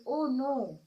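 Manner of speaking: a loud, dismayed "Oh no"; loud cry. A narrator's reading. Boy Yash finding his shoe missing, crying out loud. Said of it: "he cried".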